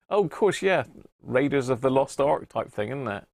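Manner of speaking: ordinary talk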